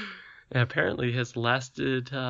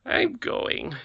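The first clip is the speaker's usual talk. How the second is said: sleepily; 13 semitones higher than usual. A narrator's reading. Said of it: "said Harelip sleepily".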